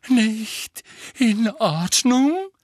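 high, loud, slow and outraged; trembling with agitation. A narrator's reading. Trelawney throws the student's question back at her, high and trembling with agitation. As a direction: echo the question in shrill disbelief. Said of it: "ihre Stimme bebte vor Erregung".

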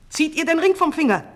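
fast, high, loud order; commanding. A narrator's reading. King ordering his guards, commanding. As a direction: fire off an urgent command. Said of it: "befahl ihr der Leibwache".

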